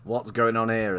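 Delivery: ordinary talk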